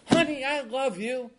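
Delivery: practically calling it across the room. Retold or imitated.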